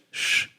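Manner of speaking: "sh" as a soft, breath-only hush; quiet, smooth "sh" for German sch; correct German "sch". Shown as a demonstration. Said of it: "or the S C H".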